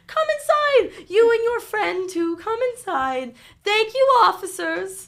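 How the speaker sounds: very high, loud put-on granny; changed grandma voice. Pretend grandma grabs your arm before the officers, changed granny voice. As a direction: usher them in as an exaggerated old grandma. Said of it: "Her voice has completely changed".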